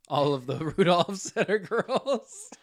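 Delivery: laughing through the words; words broken up by giggles. Real, not acted. Laughing while repeating partner's line.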